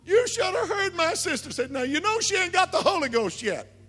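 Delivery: very high, loud retold tirade; yelling rant. [name] telling Joe how his sister raged, yelling. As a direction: shout it all at full pitch, like an acted rant. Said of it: "she yelled, she screamed and said".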